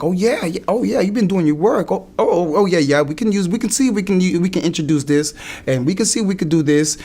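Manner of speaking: imitating an enthusiastic lawyer; eager approval. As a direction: jump on the ideas eagerly. Acts out lawyer welcoming his ideas.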